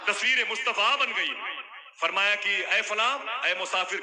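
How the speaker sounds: high, loud fervent sermon climax; preaching at full force. Acted. Preacher bringing the story to its climax, shouting. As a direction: build the crowd to a fervent peak.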